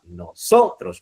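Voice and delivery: "Nosotros" with SO stressed; stress on penultimate syllable. Shown as a demonstration. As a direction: lean on the middle syllable of "Nosotros", then fall. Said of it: "en la penúltima sílaba".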